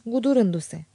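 plain reading voice

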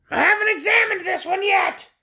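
very high, squawky orc voice; high-pitched creature recounting its troubles; shrill creature. A narrator's reading. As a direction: tell it in a piercing bird voice. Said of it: "answered the orc".

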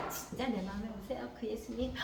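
hushed, low, earnest plea; sincere. Acted. Child really inviting Jesus into their heart, soft sincere prayer. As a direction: pray it softly, really meaning it. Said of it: "진짜로 한 사람".

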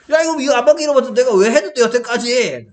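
높고 크게, 빠르게 쏟아내는 답답함; 학생에게 속이 터진 선생님을 과장해서 재연; 속 터지는 호통. Retold or imitated. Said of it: "막 속이 터져갖고". (속이 터져서 빠르고 높게 따지기)

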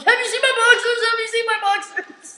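high, loud prank voice with laughter creeping in; mock-frantic asking. Boy waking a sleeping cabinmate with a prank, mock-frantic. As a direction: burst in with urgent high-pitched questioning.

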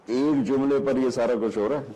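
ordinary delivery

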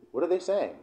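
ordinary talk, nothing marked

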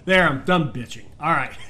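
normal talking voice

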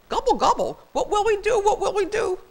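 put-on high, loud fretting old turkey; high-pitched helpless fluster; panicked gobbling.

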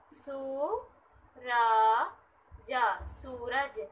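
सूरज said slowly, ज then the whole word; slow syllable blending. Shown as a demonstration. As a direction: build Suraj sound by sound, slowly. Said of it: "ऊ का sound आ रहा है". (धीरे-धीरे जोड़कर सूरज)

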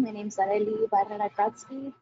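usual speaking voice

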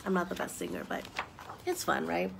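ordinary delivery, nothing marked

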